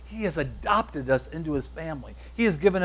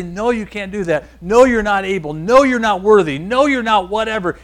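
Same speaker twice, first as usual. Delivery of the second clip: loud, higher mock rebukes; forceful put-down; 5 semitones higher, 9 dB louder than usual. Preacher acting out people who push believers down, loud and forceful. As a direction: hammer each NO like a naysayer. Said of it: "people are trying to push us down and say".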